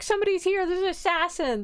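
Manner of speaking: high-pitched mock alarm; retold alarm. Woken woman warns of an assassin, mock alarm. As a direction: raise the alarm in a high, put-on voice. She wakes to an intruder.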